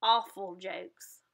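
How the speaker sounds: slow, low, dry put-on voice; deadpan the verdict; unimpressed bird. Bird mocking the dolphins' jokes, slow and dry. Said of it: "the bird beside him says".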